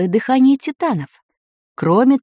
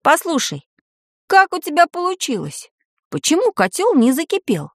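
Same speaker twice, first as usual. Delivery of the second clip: higher, mildly amazed questioning; 6 semitones higher than usual. A narrator's reading. Boy asking Tanya how she managed it, mildly amazed. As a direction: ask with a touch of wonder. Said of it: "изумился Ванька Валялкин".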